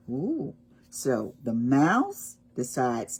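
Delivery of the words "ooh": ordinary delivery, nothing marked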